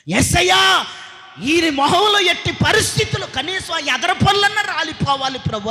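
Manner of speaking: high, loud shouted prayer; fierce mock prayer. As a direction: pray it out with furious zeal. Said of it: "ముప్పై రెండు పళ్ళు రాలేటట్లుగా ప్రార్థన చేయి".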